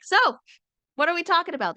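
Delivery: high, breezy innocent question; light chirpy what-are-we-talking-about; mock innocent. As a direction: ask it sweetly and casually. Voicing her calm casual self.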